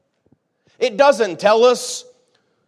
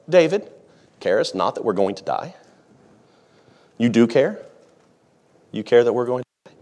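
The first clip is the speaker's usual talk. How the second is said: mock calm plea; 7 semitones lower than usual. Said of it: "that's how they said it".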